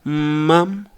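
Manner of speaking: "Mom" with pitch gliding up; rising intonation. Shown as a demonstration. Teacher modelling a child calling for attention, rising "Mom". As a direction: lift the end of "Mom". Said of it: "the intonation goes up".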